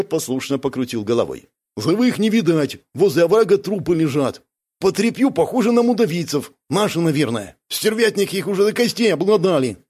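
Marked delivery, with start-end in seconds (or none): 1.8-9.8 slightly raised, higher character voice; lightly voiced Бяка. Scout reporting corpses by the ravine, higher voice. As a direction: report what you see, pitched up.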